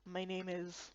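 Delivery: low, soft elder saying "My name is"; old Kappa voice. Acted. Old Kappa introducing himself in a low quiet voice. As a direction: let the Kappa slowly begin "My name is".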